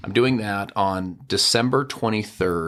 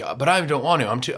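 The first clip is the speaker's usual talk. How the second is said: whiny excuse; 8 semitones higher than usual. Retold or imitated. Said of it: "people are like".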